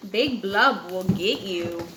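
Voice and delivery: low, eerie warning voice; spooky warning. Acted. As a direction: spook her with it. Tadpoles scaring Tad.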